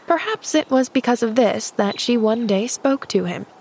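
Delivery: plain reading voice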